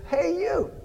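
very high bird voice, calling out; talking bird. Acted. Bird hailing the customer a second time, high bird voice. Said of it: "all at once he hears this".

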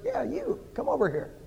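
high on the first words, then dropping; soft bird voice, starting high and falling; talking bird. Acted. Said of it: "and the bird says".

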